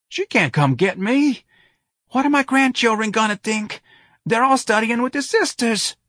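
put-on high, anxious old man's voice; pleading old man. A narrator's reading. Old man begs the sergeant not to call his daughter, pleading. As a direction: beg to be spared the shame.

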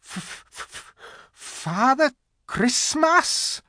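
terrified stammer, drawn out; stammering fox. A narrator's reading. Frightened fox answering the angry witch. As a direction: force the name out through fear. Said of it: "stammered the fox".

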